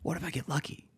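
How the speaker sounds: soft high wishful voice; hopeful small voice. Acted. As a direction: wonder it softly. Hopeful inner voice.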